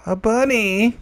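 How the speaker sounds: high, lilting little coo; odd high squeal. Real, not acted. Player revealing the egg's pet, high-pitched coo. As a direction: say it way up high, rising at the end. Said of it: "why I said it that way".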